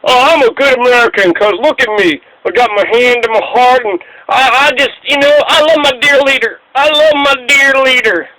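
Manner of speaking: high, fawning mock patriot; mock jingoist. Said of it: "look at all these jingoistic assholes".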